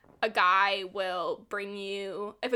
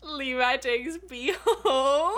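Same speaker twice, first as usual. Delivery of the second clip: drawn-out, swooning repeat; slow, gushing delight; 4 semitones higher, 4 dB louder, much slower than usual. Real, not acted. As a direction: savor it slowly, stretching the last word. Swooning repeat of plot point.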